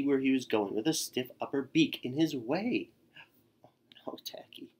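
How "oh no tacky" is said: soft unvoiced whisper; whispered aside. A narrator's reading. Narrator whispering in worry about Tacky.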